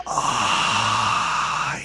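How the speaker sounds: low airy whisper, pleased; eerie satisfied whisper. Acted. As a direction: breathe it out with dark satisfaction. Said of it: "low, whispery, airy voice".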